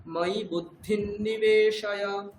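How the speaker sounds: slow, deliberate Sanskrit verse; measured verse reading; slow recitation. Shown as a demonstration. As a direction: chant it slowly for the student to copy. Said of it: "teacher corrects the phrase".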